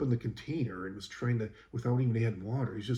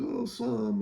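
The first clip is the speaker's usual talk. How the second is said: much higher, lightly voiced sheepish reply; mock sheepish coworker; 12 semitones higher than usual. Coworker asked if paint got on the wall, mock sheepish voice. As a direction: imitate him admitting it sheepishly. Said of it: "And he's like".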